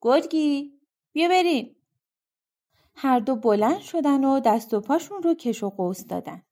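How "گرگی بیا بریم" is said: higher, light call across the cave; calling the dog. Acted. Rip after waking calls his dog, mild higher call. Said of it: "سگش رو صدا زد".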